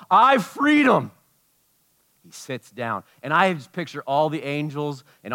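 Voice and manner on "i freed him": high, loud and slow; triumphant. Retold or imitated. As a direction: declare it in triumph. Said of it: "Jesus speaking".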